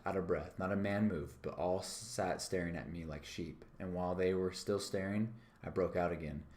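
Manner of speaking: ordinary delivery, nothing marked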